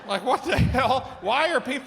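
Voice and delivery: baffled disbelief; exasperated. Real, not acted. Indignant at big-head challengers.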